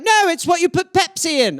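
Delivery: high put-on English voice; high mock English parent. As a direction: explain like a patient Brit. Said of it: "They were like".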